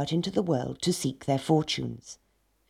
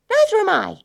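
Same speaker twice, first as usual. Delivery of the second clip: squeaky high piglet voice; high little pig voice; 9 dB louder than usual. Acted.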